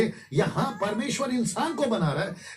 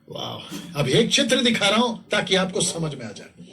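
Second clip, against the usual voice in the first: usual speaking voice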